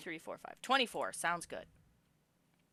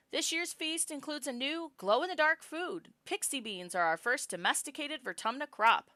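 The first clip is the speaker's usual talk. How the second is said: higher, louder public address; mom's announcement; 8 semitones higher, 5 dB louder than usual. Acted. Mom addressing the festival crowd, in a higher announcing voice.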